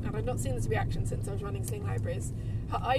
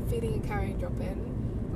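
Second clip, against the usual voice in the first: normal talking voice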